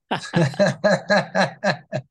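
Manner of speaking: a short, bright burst of laughter. Real, not acted. Guest laughing at his punchline about viela, short laugh. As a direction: laugh at your own joke.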